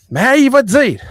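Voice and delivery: cri aigu et fort en imitant le grand-père; grand-père qui crie. Retold or imitated. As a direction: lancer ça comme un grand-père qui gueule. Grand-père qui engueule, imité. (imitation criée du grand-père)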